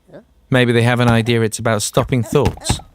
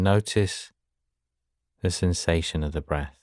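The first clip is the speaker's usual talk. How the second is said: guided meditation; 4 semitones lower, 6 dB quieter than usual. Acted. Teacher guiding listeners to follow the breath, soft guided meditation. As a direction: ease them gently through each breath, hushed and unhurried.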